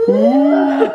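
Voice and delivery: high squeal into laughter; scream into giggles. Real, not acted. Dad pretending to be scared.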